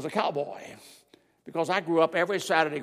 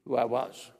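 ordinary talk, nothing marked

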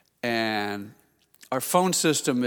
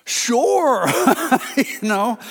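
very high, loud drawn-out yes; starstruck eagerness; 10 semitones higher, 7 dB louder, much slower than usual. Retold or imitated. Pastor agreeing to chat with his hero, comically over-eager. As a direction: yelp it out far too eagerly.

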